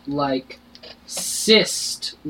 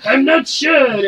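barkeep voice; 8 semitones higher, 4 dB louder, slower than usual. Acted. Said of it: "Your voice".

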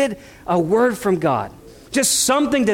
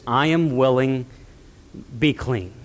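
tender; 7 semitones lower, 3 dB quieter than usual. Acted. Jesus heals the leper.

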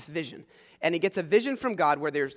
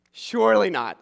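louder, firm objection; emphatic refusal; 5 dB louder than usual. Acted. Peter insists to the heavenly voice, louder and firm. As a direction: push back hard the second time.

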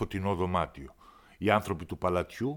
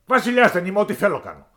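high, loud royal tantrum; a spoiled king shouting his complaint; petulant shouting king; angry king; 14 semitones higher, 13 dB louder than usual. Acted. King dismisses the minister.

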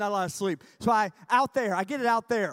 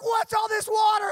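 loud, high confused yell; alarmed shout; 12 semitones higher, 10 dB louder than usual. Retold or imitated. Tentmates waking up soaked, alarmed shout. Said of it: "And they're like".

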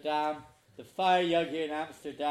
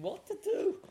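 nervous. Retold or imitated. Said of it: "I was nervous. I was feeling".

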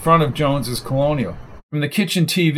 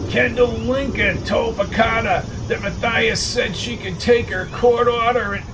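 higher pitched gossipy neighbour voice; higher, needling busybody stirring trouble; Arnie the busybody; 8 semitones higher than usual. A narrator's reading. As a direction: play the nosy troublemaker spreading gossip.

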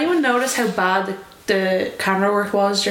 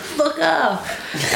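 imitation Californian accent, higher pitch; thick Californian accent; 4 semitones higher than usual. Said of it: "in that accent that he has, real thick Californian accent".

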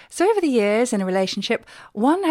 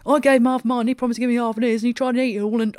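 loud, higher-pitched aggrieved bear; loudly protesting bear; 4 semitones higher, 4 dB louder, faster than usual. A narrator's reading. Large bear argues over shared toffee, loud protest. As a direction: protest loudly in one unbroken grumble. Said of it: "protesting loudly".

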